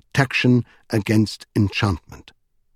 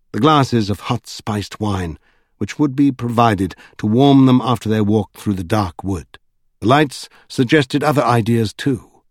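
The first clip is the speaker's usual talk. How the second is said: ordinary delivery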